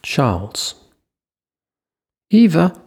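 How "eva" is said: loud, much higher call across the car; high-pitched shout. A narrator's reading. Leo calling to Eva from the car, high-pitched shout. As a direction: yell her name high and loud. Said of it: "shouted in a high-pitched voice".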